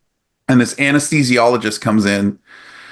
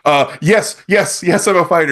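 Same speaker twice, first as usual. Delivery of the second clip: retold flustered, higher-pitched assurance; nervous insistence; 6 semitones higher than usual. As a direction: blurt it out like a rattled patient. Retold scared answer to doctor.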